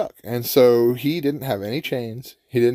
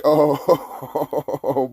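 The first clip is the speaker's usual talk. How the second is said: long oh rolled out in chuckles; reenacted laugh at the tables turning; gloating chuckle; 3 semitones higher than usual. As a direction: laugh through a drawn-out oh. Reliving becoming the one laughing.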